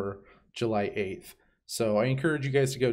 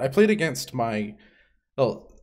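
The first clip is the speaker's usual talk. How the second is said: ordinary talk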